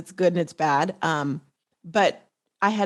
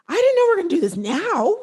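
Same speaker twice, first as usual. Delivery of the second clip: high, louder panicked squeak; mock panic; 11 semitones higher, 4 dB louder than usual. Guest retelling her shock at the sudden offer, high mock panic. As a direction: sell the startled alarm.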